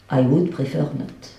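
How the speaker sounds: put-on homegrown English accent. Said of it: "Ça, c'est l'accent anglais".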